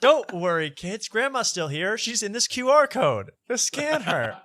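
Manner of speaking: high-pitched, hammed-up comforting voice; mock reassuring parent. Parody parent telling kids about grandma's code, mock reassurance. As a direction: reassure the children in a high, silly sing-out.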